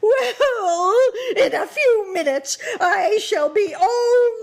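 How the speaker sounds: high, loud, crying, half-cackling witch; dying witch lamenting to Dorothy, crying; wailing witch. A narrator's reading. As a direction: wail through sobs as you melt away.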